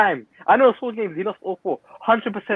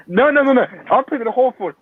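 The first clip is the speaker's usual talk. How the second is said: no, no, no, no, rising higher and louder in disbelief; excited protest; 7 semitones higher, 3 dB louder than usual. Real, not acted. Pundit rejecting the Dutch goalkeepers, with an excited, higher, louder no, no, no. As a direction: shut the idea down with excited repeated nos.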